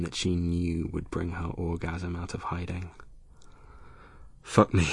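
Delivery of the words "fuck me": louder, breathless urgent plea; gasped plea. A narrator's reading. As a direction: beg him on a sharp breath. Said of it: "she gasped".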